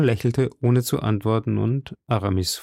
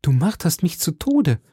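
higher-pitched, tormented protest; exclaimed; 7 semitones higher than usual. A narrator's reading. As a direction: beg a teasing friend to stop. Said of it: "rief Aramis". (gequält ausgerufen)

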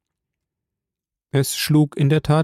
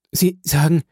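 frightened; 4 semitones higher than usual. A narrator's reading. Valentin repeats his words to the count, afraid. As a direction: ask "Sie sagen" with rising fear. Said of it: "im höchsten Maße erschrocken".

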